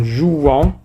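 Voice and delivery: "jouant" slowly, the nasal "an" held; drawn-out nasal ending. Shown as a demonstration. Teacher showing how A N is pronounced, "jouant" with a long ending. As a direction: stretch the end of "jouant", rising. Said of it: "nous la prononçons en".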